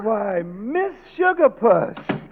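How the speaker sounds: high, very slow startled exclamation; flustered surprise. Acted. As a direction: stretch it out, caught off guard. Professor surprised at visitor.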